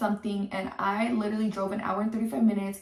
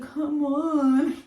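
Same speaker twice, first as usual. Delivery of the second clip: higher-pitched imitation of coaxing; mock pleading; 5 semitones higher than usual. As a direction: mimic her pleading with him, pitched up. Mimics girlfriend clinging to him.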